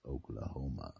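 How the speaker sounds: ordinary delivery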